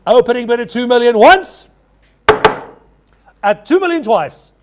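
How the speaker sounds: loud going once, going twice; auctioneer calling. Real, not acted. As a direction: ring out the final call. Auctioneer closing the lot.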